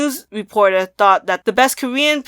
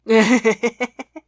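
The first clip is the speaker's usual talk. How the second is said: amused laugh. Real, not acted. Podcaster winding up a long episode, amused laugh. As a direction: run of real laughter.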